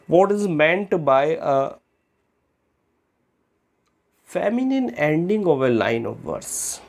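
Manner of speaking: drawn-out textbook reading; slow question reading. Shown as a demonstration. Teacher to students reading the question, slow and clear. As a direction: read the exam question slowly and clearly, word by word. Reads exam question slowly.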